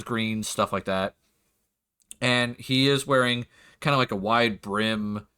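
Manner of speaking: normal talking voice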